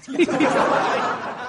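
short high breathy chuckle; brief amused giggle; laugh. Real, not acted. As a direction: chuckle at the gross story. Laughs at smelly insole story.

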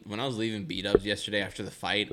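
ordinary delivery

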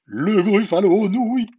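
high, loud, wavering scream; cried out. A narrator's reading. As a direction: shriek it as the terrified gruffalo. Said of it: "cria le gruffalo".

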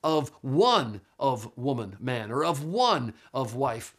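slow, with "one" punched each time; stress on one. Shown as a demonstration. Teacher explaining Greek word order, heavy stress on "one". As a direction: lean long and hard on each "one". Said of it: "the emphasis in this requirement is on one".

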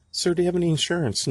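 quick mock counter clerk; pharmacist voice. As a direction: rattle it off like a busy pharmacist. Pharmacist voice, retold.

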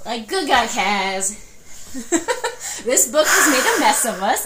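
loud, high, overwhelmed; laughing through tears. Real, not acted. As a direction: exclaim half crying, half laughing. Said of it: "we're both crying again".

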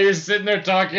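ordinary delivery

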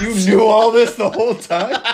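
loud, high, laughing outrage; overacted movie scene. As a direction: ham up the betrayal. Acting Spider-Man movie confrontation.

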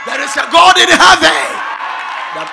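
loud, high shouted preaching; preaching at full force. Acted. Preacher shouting a warning to the borders of Ghana, full force. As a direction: thunder it at the congregation.

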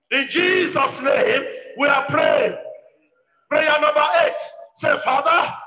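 loud, emphatic preaching prayer in short bursts; forceful prayer leading. Real, not acted. Preacher pleading with God to reject Satan's plans, forceful prayer. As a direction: cry out each petition with insistence.

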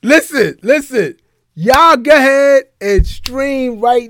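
promo announcement. Acted. Host plugging the guest's new single and album, slow announcer read. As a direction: spell out the dates like a radio plug.

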